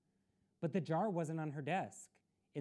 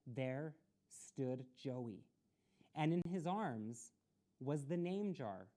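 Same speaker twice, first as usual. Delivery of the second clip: ordinary delivery, nothing marked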